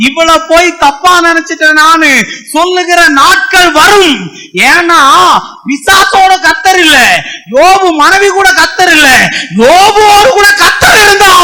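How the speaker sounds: preacher rising to a roaring climax; preaching at full force. Acted. Preacher to congregation about Job, building to a crying shout.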